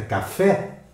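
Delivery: "café" rising high on FÉ; stress on last syllable. Shown as a demonstration. Teacher giving students an example word, "café" stressed on FÉ. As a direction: punch the final FÉ of "café". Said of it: "a sílaba que eu pronuncio com destaque é a última".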